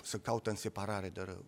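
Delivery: ordinary delivery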